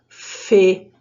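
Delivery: "fê" with a closed e; close-mouthed, clearly sounded "fê"; closed vowel. Shown as a demonstration. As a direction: narrow the e in "fê". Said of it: "som dela de origem".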